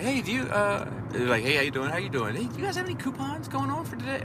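mock timid customer. Friend mocking how he asked for coupons, squeaky high.

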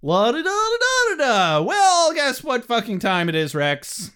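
high, singsong silly voice; mock Riley humming along; goofy Riley. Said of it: "Riley's literally like".

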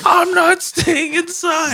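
put-on very high, drawn-out kid wail; Ralphie from The Simpsons. Grounded brother refusing to stay in his room, slow high mock wail. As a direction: stretch out a squeaky protest. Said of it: "like Ralphie from The Simpsons".

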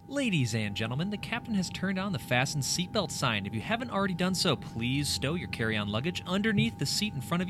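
crisp flight attendant announcement. Acted. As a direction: read it like a cabin safety announcement. Performs preflight safety announcement.

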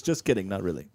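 ordinary delivery

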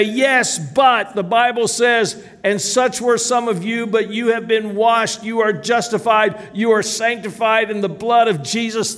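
high-pitched, forceful sermon delivery; fervent preaching. Real, not acted. Preacher answering the enemy's accusations with scripture, fervent preaching. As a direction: build the enemy's charges and the answers into ringing proclamation.

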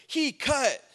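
put-on high-pitched indignant child's cry; tattling kid. Kid who got shoved in line, high tattling cry. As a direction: imitate a kid loudly telling on someone.

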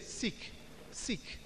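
soft unvoiced repetition; whispered word. Shown as a demonstration. Preacher whispering scripture word by word. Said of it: "take each word and begin to say it to yourself".

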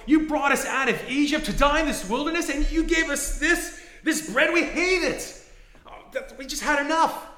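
loud, high whining complaint; complaining Israelites. Retold or imitated. Israelites grumbling to Moses in a loud, high complaint. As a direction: whine it like a fed-up crowd. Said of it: "They complained, they go".

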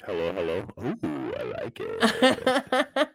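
slow, warped effect voice; voice disguiser effect. Acted. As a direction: speak through the voice changer. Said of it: "That's your voice disguiser".

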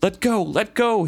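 high, mildly desperate read-aloud cry; acted cry of desperation. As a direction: plead to be released, pitched well up. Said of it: "he cried desperately".

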